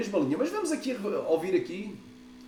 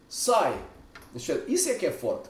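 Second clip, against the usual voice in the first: normal talking voice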